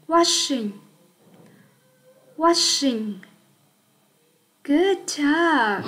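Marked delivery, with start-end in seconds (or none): 0.1-3.1 "Washing" said clearly for repetition; repeat model. Shown as a demonstration. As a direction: lead the class in "Washing". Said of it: "Cô mời các em đọc theo cô". (đọc mẫu "Washing" cho các em lặp lại)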